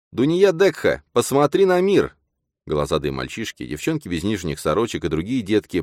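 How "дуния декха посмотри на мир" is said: высокий громкий выкрик ярмарочного зазывалы; зазывай детей на улицу во всё горло; громкий зазывала. A narrator's reading. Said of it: "трещотка Лифафы Даса и его голос". (громкий ярмарочный зазывала)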